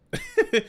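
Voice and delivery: quick high chuckle; a short burst of laughter; laughing after the rant. Real, not acted. Laughing at his own story.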